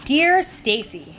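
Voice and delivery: normal talking voice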